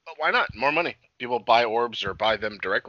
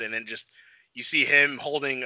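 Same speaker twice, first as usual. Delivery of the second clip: ordinary delivery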